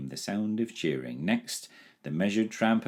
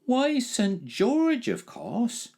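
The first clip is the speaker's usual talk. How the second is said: put-on high, slow, drawn-out boyish voice; knowing village boy; much slower than usual. A narrator's reading. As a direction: savour the big news slowly. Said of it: "replied his friend".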